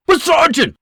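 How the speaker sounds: high, loud protest; protesting private. Acted. Private protesting to his sergeant, high and loud. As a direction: blurt a protest.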